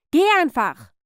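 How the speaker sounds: loud, high outburst; angry shout. Acted. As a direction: yell at the niece to get out. Repeats dismissal, now exploding.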